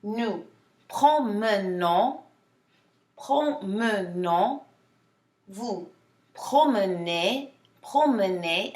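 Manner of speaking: "promenons" and "promenez" slowly, middle E as a relaxed uh; unsmiled middle E. Shown as a demonstration. Teacher contrasting two verb sounds, slow unsmiled E. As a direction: draw out "promenons" and "promenez" without the smiled eh. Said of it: "I didn't smile for either one".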